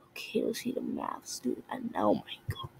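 hushed muttered checking; whisper. Real, not acted. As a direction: whisper to yourself. Quiet checking.

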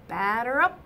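loud umpire call; umpire's call. Acted. Umpire calling the next batter up, loud call. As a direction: bark it out across the field. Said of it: "says the umpire".

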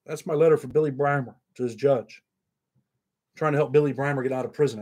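usual speaking voice

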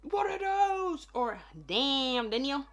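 high, loud meme impression; meme catchphrase. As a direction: yell out old memes playfully.

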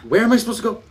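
high, frustrated repeat of the question; exasperated. Real, not acted. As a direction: burst out, lost and fed up.

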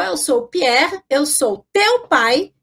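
ordinary talk

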